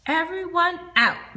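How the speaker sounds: high, loud call; loud order. Acted. Miss Mumphrey calling children off the bus, loud. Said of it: "Miss Mumphrey said".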